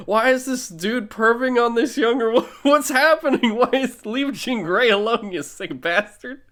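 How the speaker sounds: high, loud disgusted outrage; shocked protest at the screen; outraged. Retold or imitated. Outraged reaction to movie.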